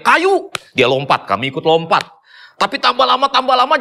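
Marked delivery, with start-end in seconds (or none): 0.0-0.5 high shouted warning, one word; retold warning yell. Young hiker yelling a warning back to the group, high shout. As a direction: call out the danger ahead, pitched way up. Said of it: "dia teriak".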